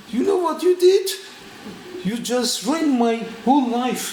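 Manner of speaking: high loud furious outburst; very angry. Retold or imitated. Guest yells at his hostess over the burned drugs, high and loud. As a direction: scream it at her like she ruined everything. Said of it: "And then I was very angry".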